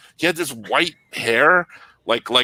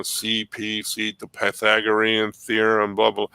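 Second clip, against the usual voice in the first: slow teacher drone; 3 semitones lower than usual. Retold or imitated. The slow teacher lecturing the class, a slow drone. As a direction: drone through geometry like him. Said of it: "Mister Lotion was up there going".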